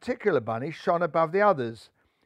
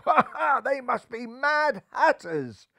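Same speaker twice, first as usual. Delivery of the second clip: excited; 5 semitones higher, 4 dB louder than usual. A narrator's reading. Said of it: "exclaimed the bunnies".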